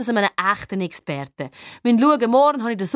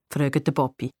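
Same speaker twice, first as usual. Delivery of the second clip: ordinary delivery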